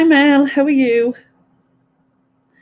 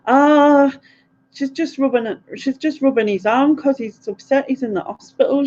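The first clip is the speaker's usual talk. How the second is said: higher, knowing explaining mum voice; imitating mum; 7 semitones higher than usual. Mum on the phone, higher explaining voice. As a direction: mimic mum explaining confidently. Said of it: "then she'll be like".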